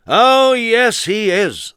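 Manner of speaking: high, loud crowd reply; crowd shout. A narrator's reading. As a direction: shout back together. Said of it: "they all shouted".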